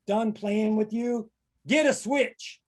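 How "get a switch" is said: much higher, commanding mama voice; stern mama. Acted. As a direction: order it like a fed-up mother. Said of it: "phrase from my mama".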